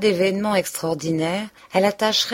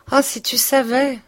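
higher and louder, breathless cry; anguished outburst; 7 semitones higher, 4 dB louder than usual. Acted. Emma to Rodolphe, anguished outburst. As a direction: let the pain burst out. Emma pours out her distress.

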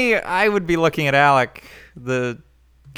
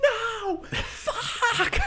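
falsetto; 5 dB quieter than usual. Retold or imitated. Mock high-pitched dismay.

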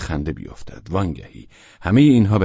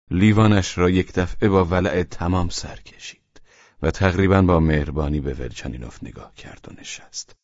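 ordinary delivery

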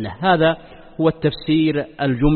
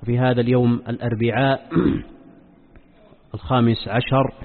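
solemn, measured sermon opening; formal standard arabic; 4 semitones lower, 6 dB quieter than usual. Acted. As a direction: recite the opening praise formally. Formal opening praise formula. (افتتاحية خطبة رسمية هادئة)

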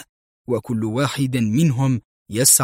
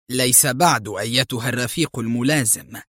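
usual speaking voice

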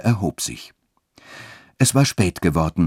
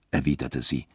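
ordinary delivery, nothing marked

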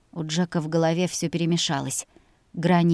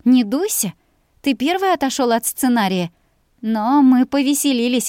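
teasing, higher playful voice; playful young woman; 5 semitones higher, 5 dB louder than usual. Acted. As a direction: coax him playfully. Playful girl teasing Jack.

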